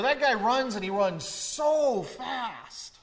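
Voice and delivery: high, exclaiming, "so" stretched long; emphatic drawn-out "so"; stress on SO. Shown as a demonstration. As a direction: heighten "so" as in amazed everyday talk. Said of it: "we would heighten something".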